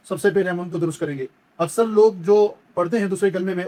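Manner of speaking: usual speaking voice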